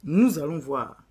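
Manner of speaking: Satan scoffing, then taking up the bet; Satan's voice. Acted. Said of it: "Là, c'est Satan maintenant".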